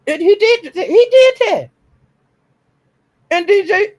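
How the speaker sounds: can't hold back the excitement; excited. Real, not acted. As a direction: squeal it with delight. Gushing over DG's stand.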